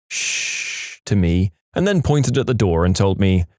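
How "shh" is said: soft, drawn-out shush; whispered shush. A narrator's reading. Boyfriend silencing partner at night, whispered shush. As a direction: hush them with a long breathy shh.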